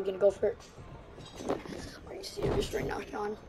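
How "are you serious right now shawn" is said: incredulous whisper. Real, not acted. Disbelief at the game.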